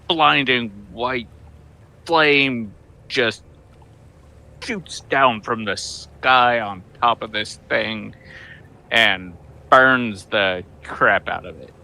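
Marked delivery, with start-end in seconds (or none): none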